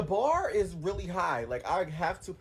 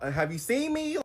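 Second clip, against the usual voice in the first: ordinary talk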